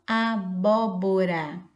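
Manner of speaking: abóbora slowly, syllable by syllable; slow model word. Shown as a demonstration. Teacher giving rá at the end, abóbora slowly. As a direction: stretch out abóbora for learners.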